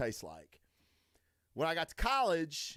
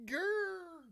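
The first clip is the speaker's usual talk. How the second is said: playful growl; 3 dB quieter than usual. Retold or imitated. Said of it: "And then I'm like".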